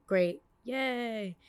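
ordinary talk

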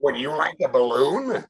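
creepy clown voice; a sinister clown offering a treat; Pennywise voice. Retold or imitated. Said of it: "that was tremendous in its accuracy".

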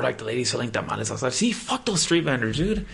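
ordinary talk, nothing marked

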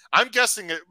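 ordinary talk, nothing marked

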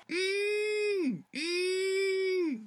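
long, slow lowing hum; cow lowing imitation. Boy showing dad how a cow really lows, slow and drawn out. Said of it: "They go".

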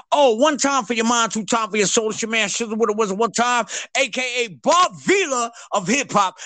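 high, hyped-up show host; hype host intro. Pretend. Shan opening his own hip hop building show, hype host voice. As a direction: announce yourself like a TV host.

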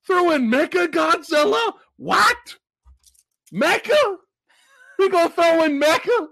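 high, loud incredulous questions; playful disbelief. Pretend. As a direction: exclaim as if you can't believe the treat. Mock disbelief at bonus monster.

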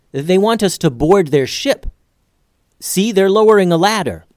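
keyed-up lookout report; alert calling out. Acted. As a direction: alert your crewmates to what you see. Reporting what the ship is doing.

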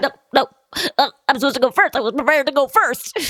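grieving mother saying she should die first, pleading; Sally Field impression. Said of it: "That's just mine".

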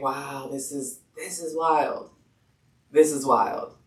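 soft, low marvelling; quietly stunned, voice dropped; hushed awe. Retold or imitated. Retells gazing in awe at newborn.

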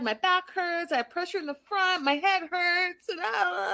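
high, whiny list of ailments; mock complaining. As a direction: whine it singsong like a tiresome patient. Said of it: "she's complaining".

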